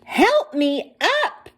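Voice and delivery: high, slow plea to friends; pleading. A narrator's reading. Humpty pleading with his pals to help him up, high and slow. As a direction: beg them to lift you, drawn out. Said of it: "pleaded Humpty".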